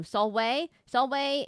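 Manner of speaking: "Solvay" said sol-way. Shown as a demonstration. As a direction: Latin greeting "Solvay" with a W sound. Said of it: "V is pronounced like a W".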